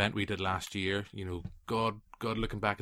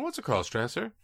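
higher-pitched imitation of a curious kid; child's innocent question; 7 semitones higher than usual. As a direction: ask it like a puzzled child. Acting a child asking an adult.

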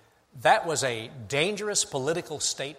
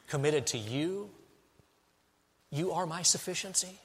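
softer, a quiet confession; humble prayer; 6 dB quieter than usual. Acted. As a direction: pray it softly, broken before God. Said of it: "be broken before God and say".

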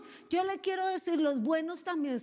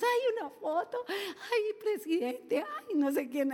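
higher, gushing fan voice with a laugh; mocking star-struck fans; 6 semitones higher than usual. Preacher mocking people fawning over politicians, gushing parody. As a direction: parody fans chasing a photo. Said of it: "Qué chistosos".

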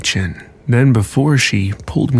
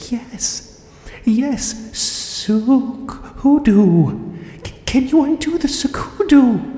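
desperate whisper; 14 semitones higher, slower than usual. Acted. Terrified wife pleading in whispers.

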